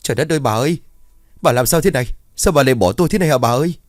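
acted high-pitched wail of a bereaved husband; wailing in grief. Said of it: "rồi ông gào lớn". (gào khóc thê lương, giọng cao)

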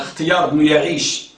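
ordinary talk, nothing marked

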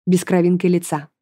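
ordinary delivery, nothing marked

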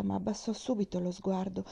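usual speaking voice

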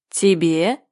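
low, drawn-out scornful question; disdainful caterpillar, pitched low; contemptuous. A narrator's reading. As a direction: look down on her with one word. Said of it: "презрительно заметил червяк".

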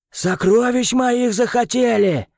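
high, slow furious yell. A narrator's reading. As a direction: bellow it at the intruders. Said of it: "орал он".

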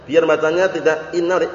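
normal talking voice

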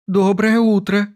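high, slow, chirpy little voice; thin trembling chirp. A narrator's reading. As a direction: chirp it like a tiny nervous bird. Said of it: "тоненьким, дрожащим голоском прощебетало". (тоненький дрожащий голосок)

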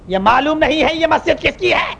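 high, slow, stretched-out challenge; imitating irate imam. Imam challenges outsider over the mosque, high angry drawl. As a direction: draw out the angry question.

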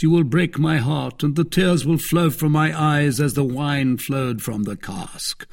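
high, loud, theatrical lament; Athos, grandly moved. Acted. Athos answering the begging innkeeper, grandly moved. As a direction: declaim it like an overblown tragedy.

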